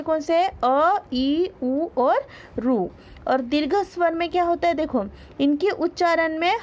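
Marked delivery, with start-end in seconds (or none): 0.6-2.9 slow vowel list. Shown as a demonstration. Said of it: "ऐसे चार कौन से".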